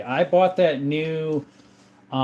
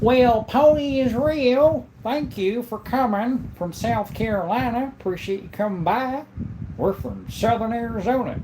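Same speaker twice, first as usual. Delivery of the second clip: put-on Southern accent; 9 semitones higher than usual. Streamer greeting a viewer from South Carolina, hammed-up Southern accent. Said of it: "we don't normally talk like this".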